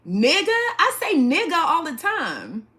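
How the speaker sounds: incredulous. Real, not acted. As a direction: scoff at the question out loud. Incredulous reaction to chat request.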